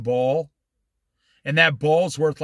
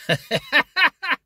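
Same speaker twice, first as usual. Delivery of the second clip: a short laugh lifting up at the end; high laugh; 10 semitones higher than usual. Real, not acted. Preacher amused by the customer's dismissal, rising laugh. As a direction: chuckle at the stupid-answer remark.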